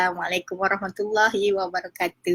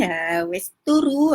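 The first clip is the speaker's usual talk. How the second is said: normal talking voice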